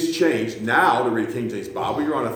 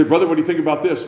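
lightly voiced eager asker, higher and fast; playful retold question, a touch higher; mock question to pastor; 6 semitones higher, 4 dB louder, faster than usual. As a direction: ask it quick and bright, a bit put on. Pastor mimics asking a question.

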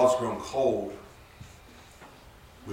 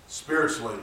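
normal talking voice

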